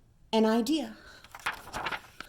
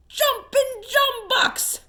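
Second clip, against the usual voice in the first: roaring; 10 semitones higher than usual. Acted. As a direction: bellow it out in surprise. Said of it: "roared Bungo".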